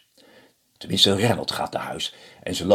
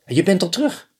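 surprised wife; 11 semitones higher, 5 dB louder, faster than usual. Acted.

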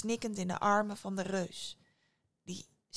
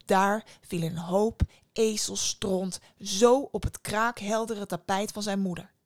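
usual speaking voice

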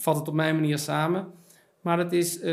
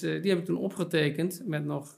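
ordinary talk

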